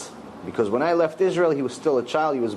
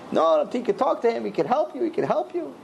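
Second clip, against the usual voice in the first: retold hysterical pleading; 8 semitones higher than usual. Crying mother urging her son to take help, hysterical pleading. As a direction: plead in a mock-frantic high voice. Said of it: "She's hysterical".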